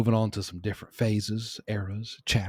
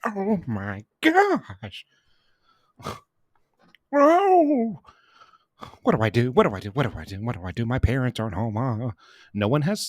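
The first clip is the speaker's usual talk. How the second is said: put-on childish panic; 4 semitones higher, 4 dB louder than usual. Little Paul panicking alone after hearing the message. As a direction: gasp it as a scared little kid.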